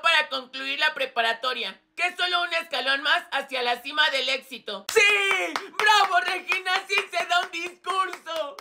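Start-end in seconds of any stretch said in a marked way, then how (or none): 4.9-8.6 cheering. Acted. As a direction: cheer her on from the crowd. Público aclama el discurso. (ovación entusiasta, aguda y fuerte)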